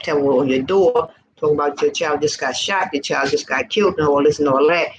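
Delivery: normal talking voice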